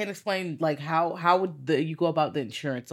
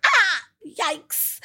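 much higher, startled cringe; mock shocked yikes; 13 semitones higher, 3 dB louder than usual. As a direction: wince at the insult, high up. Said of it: "I was like".

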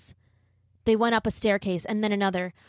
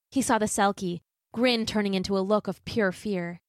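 usual speaking voice

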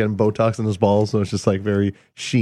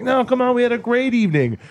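higher-pitched retold protest; pleading protest; 10 semitones higher than usual. Comedian retelling his reply to his partner, pleading protest. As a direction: protest lightly in a raised pitch.

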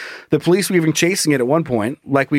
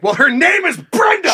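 prissy owner voice; 13 semitones higher than usual. Retold or imitated. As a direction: play the offended owner, high. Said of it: "And you're like".

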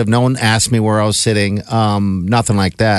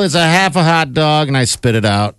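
normal talking voice

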